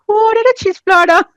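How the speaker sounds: chirpy server calling out an order; waitress voice. Retold or imitated. Waitress asks the table whose platter this is, high and quick. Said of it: "she was like".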